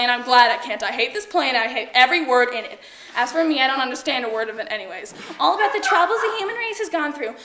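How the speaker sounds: loud, fed-up rant; exasperated outburst. Acted. Actress tells the audience she hates this play, exasperated. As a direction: break character and complain at full voice.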